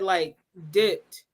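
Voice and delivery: ordinary talk, nothing marked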